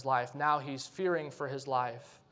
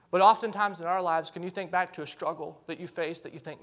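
normal talking voice

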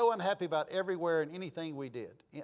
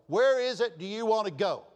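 louder and higher, pressing each word; exasperated repeat; 5 semitones higher, 6 dB louder than usual. Retold or imitated. Host asking a hard-to-please guest where to eat, exasperated. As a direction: ask it with patience running out.

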